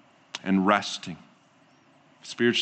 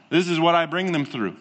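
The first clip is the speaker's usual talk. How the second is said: normal talking voice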